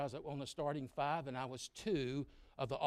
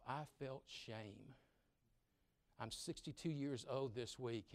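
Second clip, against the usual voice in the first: ordinary delivery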